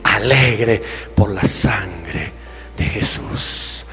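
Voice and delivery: low, hushed, slow prayer voice; reverent prayer. Acted. Preacher shows how to come to God's altar, reverent low prayer. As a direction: pray softly, stretching the declarations out.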